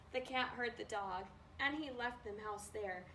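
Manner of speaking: ordinary delivery, nothing marked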